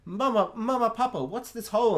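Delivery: a hint of a puzzled little boy; little boy asking. Voices boy asking parents in story.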